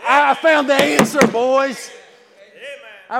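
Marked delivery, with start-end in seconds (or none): 0.1-1.9 loud, high; telling old friends he's found the answer, excited; excited announcement. Retold or imitated. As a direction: call out the good news to your old friends. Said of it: "I just wanted to tell them".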